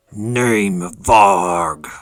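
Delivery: loud, drawn-out monster growl naming itself; booming creature voice. Acted. Wounded creature introduces himself to the party with a booming growl. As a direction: announce his name like a huge beast. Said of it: "And he goes".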